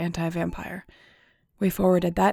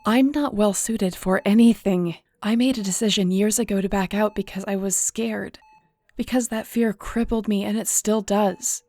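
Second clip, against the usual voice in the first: louder, pitched up, pained confession; raised in anguish; 3 semitones higher, 5 dB louder than usual. Acted. Ruby to Ethan, refusing to lead, anguished raised voice. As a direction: let the guilt burst out. Ruby's outburst of self-blame.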